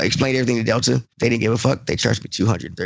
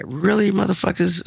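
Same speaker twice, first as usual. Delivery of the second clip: exasperated; 9 semitones higher than usual. Retold or imitated. Exasperated retelling of Delta call.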